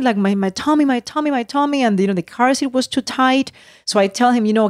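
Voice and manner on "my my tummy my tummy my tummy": imitating crying child. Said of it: "like, crying".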